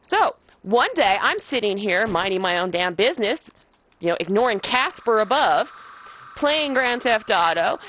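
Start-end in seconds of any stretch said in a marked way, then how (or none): none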